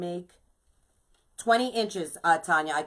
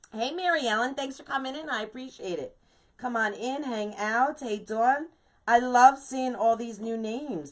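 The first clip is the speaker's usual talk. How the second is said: high, bright welcome to new viewers; high welcoming greeting; 6 semitones higher than usual. Real, not acted. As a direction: lift the voice high to welcome them.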